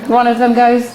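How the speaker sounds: ordinary delivery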